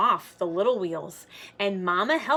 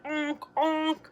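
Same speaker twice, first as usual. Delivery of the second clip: horn honk; 7 semitones higher than usual. Acted. Tricycle horn sound.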